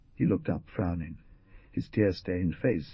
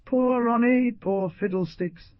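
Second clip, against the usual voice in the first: high, loud, mocking sneer; mock the pity, sneering; a sneering jab at the girl's pity; 5 dB louder, slower than usual. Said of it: "sneered Stepp".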